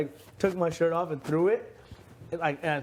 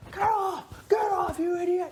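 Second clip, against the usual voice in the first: high mock shout at someone on the roof; retold yell; 12 semitones higher than usual. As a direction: bark it up high like an angry guard. Security yelling at him on tent.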